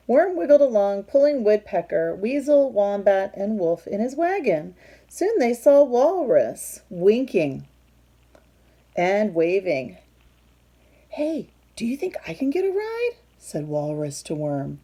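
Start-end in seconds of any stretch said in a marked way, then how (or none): 0.1-10.0 loud, lively storybook read-aloud; reading to children. A narrator's reading. Reading picture book aloud. 11.1-13.1 much higher cartoonish walrus voice. Walrus asks for ride.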